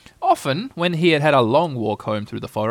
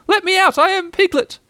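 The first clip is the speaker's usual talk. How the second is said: very high, loud squealed protest; desperate little-animal cry; 5 dB louder than usual. A narrator's reading. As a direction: squeal to be let out of the bath. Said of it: "cried Piglet".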